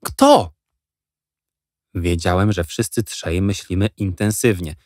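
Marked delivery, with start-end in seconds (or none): 0.0-0.5 high, louder burst; impatient who. A narrator's reading. Boys pressing Jack for the name, high and impatient. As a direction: demand the answer.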